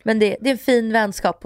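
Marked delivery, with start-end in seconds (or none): none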